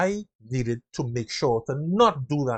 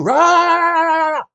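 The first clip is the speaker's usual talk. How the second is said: mock aggressive roar; 11 dB louder than usual. Mimicking people coming at you.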